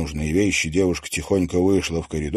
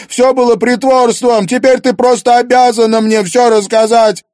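much higher and louder, near screaming; anguished outburst; 10 dB louder than usual. Acted. Daughter confronts her lying mother, anguished loud outburst. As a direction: pour out the hurt at full volume.

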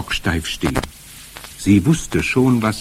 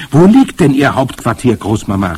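eager, raised question; calling out; 4 semitones higher, 7 dB louder than usual. A narrator's reading. Boy presses Großmama about the headquarters, calling out. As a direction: ask it loud and high, urgently. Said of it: "rief ich".

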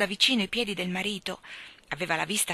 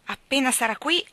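higher, defiant threat; threatening; 5 semitones higher, 3 dB louder than usual. A narrator's reading. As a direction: swear revenge like a kid. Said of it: "minacciò Michael". (minaccia infantile)

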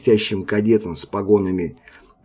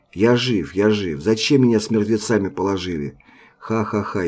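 ordinary delivery, nothing marked

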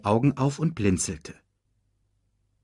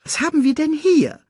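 high, loud meerkat chatter; 5 dB louder than usual. Acted. Meerkat inspecting the unconscious cub, high nosy chatter. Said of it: "schnatterte es".

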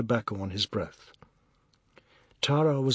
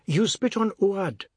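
high, slow indignant complaint; complaining; 7 semitones higher than usual. A narrator's reading. Man below to the spitter upstairs, indignant complaint. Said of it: "They heard him complaining".

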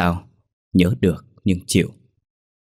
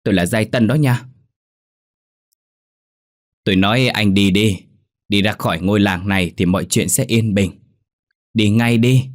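words hissed through the teeth; a ghost warning him off, lightly voiced; mild ghostly hiss. A narrator's reading. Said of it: "Giọng nói rít rít từng tiếng qua kẽ răng".

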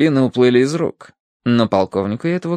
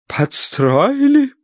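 high, drawn-out incredulous echo; affronted wife; 14 semitones higher, much slower than usual. A narrator's reading. Wife challenges her husband's accusation, affronted. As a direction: echo the word in offence.

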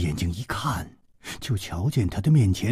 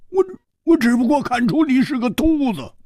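high, exasperated comeback; stammered retort; 13 semitones higher, 4 dB louder than usual. A narrator's reading. Hu Tiehua jibing at the bald dwarf, exasperated. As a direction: stumble in, then push the jibe high.

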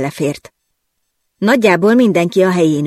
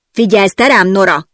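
booming in her ear; 3 semitones higher, 5 dB louder than usual. Acted. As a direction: blast it at her. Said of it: "harsogta a fülembe mister Nolan". (harsogva a fülébe)

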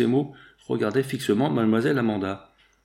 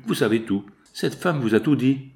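plain reading voice

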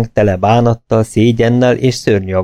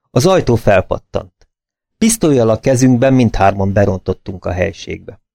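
ordinary delivery, nothing marked

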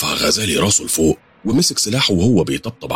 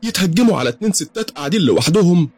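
much higher, shrill-edged indignant woman; 12 semitones higher than usual. Acted. Dida scolding the gunmen for attacking two women, indignant. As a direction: shame the armed men, furious. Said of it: "قالت ديدة بعصبية".

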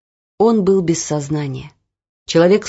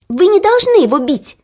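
put-on high, loud, firm girl's demand; 11 semitones higher, 6 dB louder than usual. A narrator's reading. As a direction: stand firm against the overseer. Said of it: "твёрдо сказала Оля". (твёрдо и громко)

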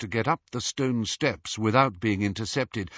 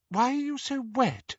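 worried wife; 13 semitones higher than usual. A narrator's reading. Mrs Cattermole questioning.

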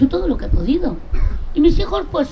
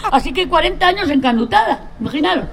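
words spoken through laughter, higher; laughing; 4 semitones higher than usual. Real, not acted. Telling friends about forty years married, laughing through it. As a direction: still laughing as you deliver the punchline. Punchline about husband's name.

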